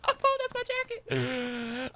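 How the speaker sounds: slow high drawn-out cry. Pretend. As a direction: stretch every word out high. Repeats jacket line slowly.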